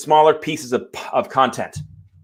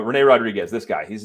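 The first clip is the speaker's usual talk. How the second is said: usual speaking voice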